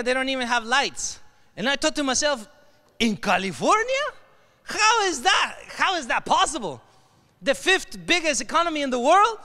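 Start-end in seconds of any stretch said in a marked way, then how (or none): 3.0-6.8 incredulous. Retold or imitated. Speaker recalling a thought about poor towns, incredulous. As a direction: question it in disbelief. Voicing own disbelieving thought.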